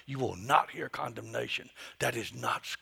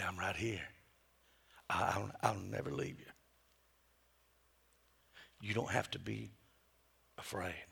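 soft whispered I love you; whispering to kids; 7 semitones lower, 7 dB quieter than usual. Retold or imitated. Parent holding a frustrated child close, whispering. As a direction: hush it close to a child's ear. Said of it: "and I'd whisper".

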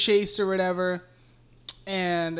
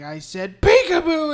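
much higher, louder burst on "peekaboo"; mock menacing shout; 9 semitones higher, 7 dB louder than usual. Chucky before he stabs her, mock shout. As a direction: pounce on "peekaboo".

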